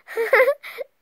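high, loud giggle; real high-pitched laugh. Real, not acted. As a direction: burst of amused giggling. Amused by spilled milk.

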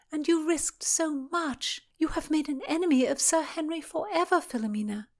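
high, distressed scolding; plaintive reproach. A narrator's reading. Constance warning Philomena of an enemy, plaintive reproach. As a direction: reproach her in a high wail.

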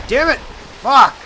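a gamer's furious swearing shout; angry outburst. Real, not acted. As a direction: explode in real frustration. Enemy attack, real frustration.

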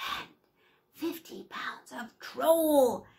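loud, slow gleeful cackling shout; loud triumphant witch; witch's triumphant shout. Acted. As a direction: pounce on the words as she grabs him. Witch springs her trap.

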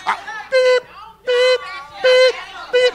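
high, reedy mock truck beep; backing-up beep. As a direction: imitate a truck reversing with high beeps. Imitating reversing truck.

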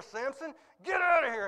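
high, strained shout at a horse; imitating an exasperated owner ordering it out; acted-out angry yell; master's command.

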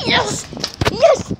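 high, loud triumphant cry; cheering. Real, not acted. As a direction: celebrate the win out loud. Cheering at a win.

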